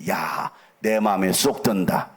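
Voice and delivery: loud, slow, booming approval; God's delight. Acted. Pastor voicing God delighting in David, slow and loud. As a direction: boom it out like a pleased God.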